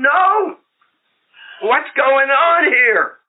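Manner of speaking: high, slow, incredulous no; shocked disbelief. Retold or imitated. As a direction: stretch it out in disbelief. Wife's shocked refusal.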